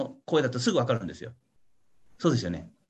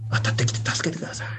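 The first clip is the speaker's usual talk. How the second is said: whispered plea; 7 semitones lower than usual. Pretend. Macedonian man's plea in vision.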